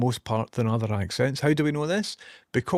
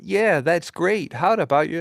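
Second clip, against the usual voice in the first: upbeat reply with swooping pitch; voice assistant's reply; 4 semitones higher than usual. Retold or imitated. Voice assistant cheerfully answering an app pitch, lightly imitated. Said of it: "She's like".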